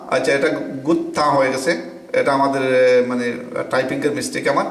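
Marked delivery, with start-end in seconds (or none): none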